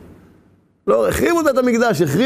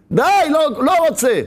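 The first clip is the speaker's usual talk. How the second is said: high, insistent protest; emphatic refusal; 7 semitones higher than usual. Acted. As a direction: cut the tempter off, pitched high. Penitent refuses the tempter.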